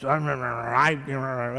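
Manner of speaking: low garbled grumble, imitating her; mock grumbling. Pastor mimicking the fuming woman's muttering, low grumbling. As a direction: mumble a low run of grievance.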